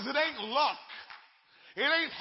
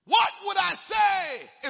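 slow, higher, louder plea; voicing Moses; 6 semitones higher, 6 dB louder, slower than usual. Preacher voicing Moses unsure before God, slow loud plea. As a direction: ask it slowly in despair. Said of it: "is what Moses said".

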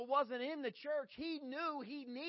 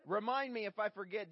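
ordinary talk, nothing marked

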